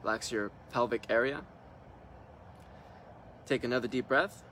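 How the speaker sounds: calm meditation leader leaving room to breathe; guided meditation. Real, not acted. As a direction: guide them into relaxing, unhurried, one short instruction at a time. Leads a guided relaxation meditation.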